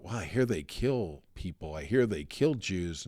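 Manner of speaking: softly retold hushed fear on the train; hushed whisper. Prisoners on the train whisper fearful rumors, hushed. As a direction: keep it low like prisoners whispering. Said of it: "they're whispering among each other".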